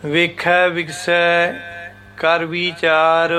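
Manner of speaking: slow recitation, resting on ਵਿਗਸੈ; pause after ਵਿਗਸੈ. Shown as a demonstration. Teacher reciting Gurbani slowly with a pause after ਵਿਗਸੈ. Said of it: "ਇੱਥੇ ਇੱਕੋ ਹੀ ਵਿਸ਼ਰਾਮ ਹੈ ਵਿਗਸੈ ਦੇ ਉੱਪਰ".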